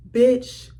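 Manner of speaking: ordinary talk, nothing marked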